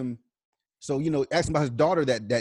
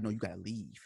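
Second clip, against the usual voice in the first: low, hushed menace with long pauses; deathly quiet; 6 semitones lower, 13 dB quieter than usual. Retold or imitated. Mike orders the interviewer out, imitated deathly quiet. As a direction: drop it right down and leave gaps between phrases. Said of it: "he gets deathly, like, quiet".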